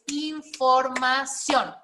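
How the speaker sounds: "información" slowly split into in-for-ma-ción; syllable by syllable. Shown as a demonstration. Teacher separating a word for the class, "información" syllable by syllable. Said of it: "vamos a separarla en sílabas".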